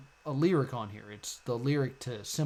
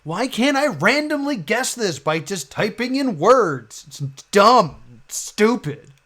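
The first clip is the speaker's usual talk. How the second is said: loud, higher frustrated rant; 7 semitones higher, 10 dB louder than usual. Real, not acted. Stuck streamer rants at the puzzle, loud frustrated outburst. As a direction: vent at the game, loud and fed up.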